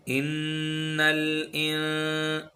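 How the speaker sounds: slow nasal hum on इन्नल; ghunna demo. Shown as a demonstration. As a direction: hold the nasal sound on इन्नल. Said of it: "गुन्ना और इख्फा की sound".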